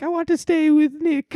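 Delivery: very high, mock child voice; squeaky hammed-up kid whine; pleading little kid. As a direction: imitate a kid begging to stay. Voicing the child not leaving.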